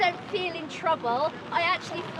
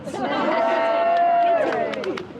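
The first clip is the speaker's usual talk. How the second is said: bright burst of laughter; 10 semitones higher, 7 dB louder than usual. Real, not acted. Laughs at own joke.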